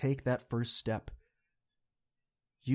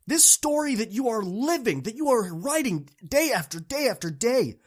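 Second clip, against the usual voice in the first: fired-up plea; 9 semitones higher, 3 dB louder than usual. Real, not acted. Passionate appeal to students.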